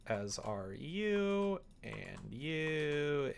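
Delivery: ordinary talk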